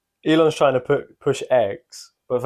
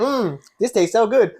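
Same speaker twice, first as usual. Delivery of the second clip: meme voice; 10 semitones higher, 3 dB louder than usual. Pretend. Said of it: "how we opened with a".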